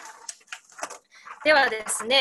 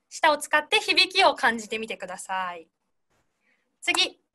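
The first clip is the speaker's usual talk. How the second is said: usual speaking voice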